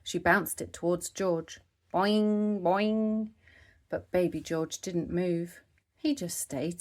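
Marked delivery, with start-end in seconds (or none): 1.9-3.3 boing sound effect. A narrator's reading. Bouncing ball sound.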